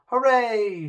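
loud, high cheer of delight; a happy princess shouting hooray; joyful shout. A narrator's reading. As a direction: shout for joy at the gold. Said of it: "shouted Poppy".

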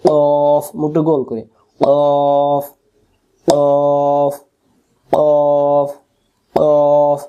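কফ thick from the tongue root with rounded lips; qaf demonstration. Shown as a demonstration. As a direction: drill কফ from the back of the tongue. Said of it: "জিহ্বার গোড়াটা যেখানে শেষ হবে".

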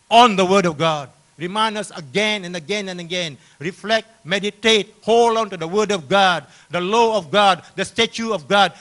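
loud, high, insistent exhortation; impassioned preaching. Real, not acted. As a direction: drive each phrase home from the pulpit. Preaches with rising force.

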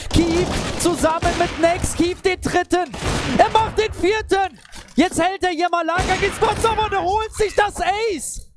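rising hype, shouting the ace; excited caster losing it over a clutch; esports caster. Real, not acted.

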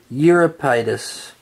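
usual speaking voice

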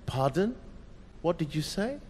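soft, gentle asking; kindly. Acted. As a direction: lean in kindly, no edge. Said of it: "She says it kindly".

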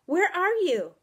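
high, loud searching call; alarmed shout; crying out. A narrator's reading. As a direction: call out for someone lost. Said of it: "I cried".

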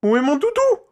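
very high, loud panicked cry; boy shrieking in alarm; panicked shout. A narrator's reading. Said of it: "s'affola-t-il".

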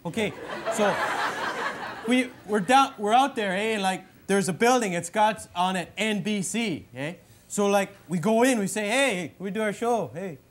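exaggerated Canadian hoser drawl, eh; Bob McKenzie hoser voice. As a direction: play the dopey Canadian brother hosting his bit. In character.

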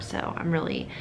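usual speaking voice

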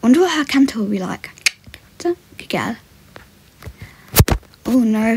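ordinary talk

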